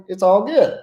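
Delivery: ordinary talk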